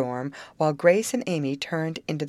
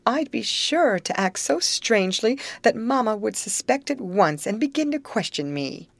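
wailing; 6 semitones higher than usual. A narrator's reading. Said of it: "with a wailing tone in her voice".

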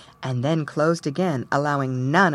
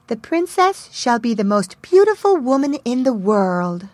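fairy voice; 6 semitones higher, 4 dB louder than usual. A narrator's reading. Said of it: "the youngest fairy stepped forward and said".

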